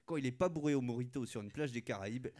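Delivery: ordinary talk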